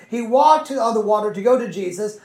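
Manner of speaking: usual speaking voice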